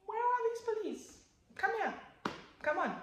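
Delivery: very high, exasperated summons; mock impatient calling. Crash victim looks round for police, mock impatient calling. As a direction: call out in a high, put-on plea for help.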